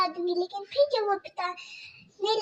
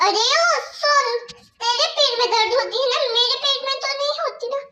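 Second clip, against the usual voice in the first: higher, louder mocking stepmother voice; harsh stepmother scolding; 6 semitones higher, 4 dB louder, faster than usual. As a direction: bark orders at the girl like a cruel stepmother. Stepmother mocks.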